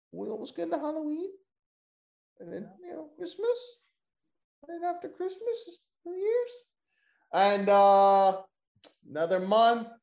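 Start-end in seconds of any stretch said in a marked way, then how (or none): 0.1-1.3 "Halloween" said high and quick; high, fast squeaky take. Shown as a demonstration. Repeating to co-host in a squeaky high voice. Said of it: "second take, higher and faster". 2.4-6.5 squeaky voice. Acted. Continues squeaky high voice.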